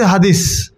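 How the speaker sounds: slow হাদিস with a clear final s; teacher modelling হাদিস; careful demo of হাদিস. Shown as a demonstration. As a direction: spell out হাদিস slowly, sound by sound. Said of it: "আমার ধ্বনিটা কীভাবে উচ্চারিত হচ্ছে".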